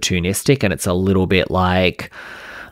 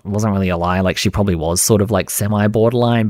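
usual speaking voice

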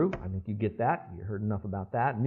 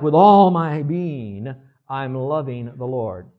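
fervent devotion; 8 dB louder, slower than usual. Retold or imitated. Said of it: "it's kinda like".

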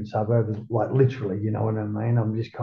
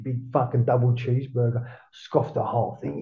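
ordinary talk

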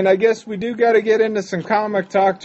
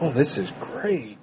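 softer, lower mesmerized Harrison; 5 semitones lower, 9 dB quieter than usual. Retold or imitated.

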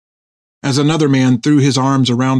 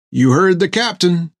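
higher, lightly amused backing-up; grinning; 6 semitones higher than usual. A narrator's reading. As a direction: say it through a grin, pitch lifted. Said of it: "Topper said with a grin".

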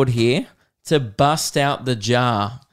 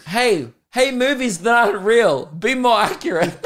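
high, louder comic rant breaking into laughter; getting angry; 9 semitones higher, 4 dB louder than usual. Acted. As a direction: scold the movies in mock fury. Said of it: "I don't know why I'm getting angry about this".

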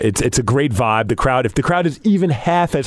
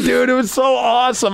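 ordinary talk, nothing marked

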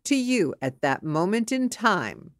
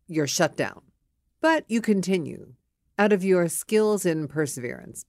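ordinary talk

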